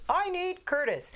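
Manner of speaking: high, slow, pleading "I need Curtis"; pleading caller voice. Pretend. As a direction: beg for Curtis like a desperate caller. Mock caller asking for producer.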